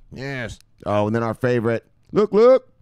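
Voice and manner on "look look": hype catchphrase. Acted. Catchphrase shout.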